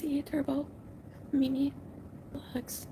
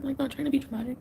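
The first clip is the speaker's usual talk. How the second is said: ordinary delivery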